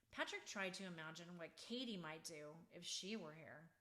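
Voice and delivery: ordinary delivery, nothing marked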